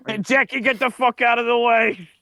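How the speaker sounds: high, hammed-up put-on voice; mock warning. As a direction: bark a silly warning to Jackie.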